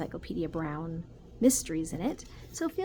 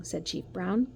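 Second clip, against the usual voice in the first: ordinary delivery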